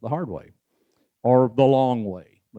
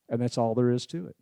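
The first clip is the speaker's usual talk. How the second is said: ordinary talk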